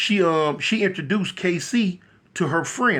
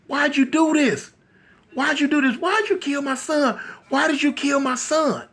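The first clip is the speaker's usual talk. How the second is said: high, grieving accusation; mock screaming mother; 6 semitones higher than usual. Capone's mother looking up at KC, high anguished demand. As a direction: wail each question in acted anguish. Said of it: "she rocking and screaming".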